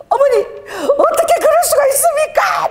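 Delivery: high, slow, tearful wail of complaint; crying high plea. Retold or imitated. Son-in-law on the phone, crying to his mother-in-law, tearful high wail. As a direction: imitate a son-in-law sobbing out his grievance. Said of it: "막 이러고 막 울면서".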